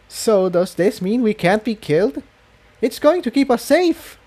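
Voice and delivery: bright rising hope, voiced high; a young mare daring to hope; Tiptoe. A narrator's reading. Tiptoe hopeful after smiling.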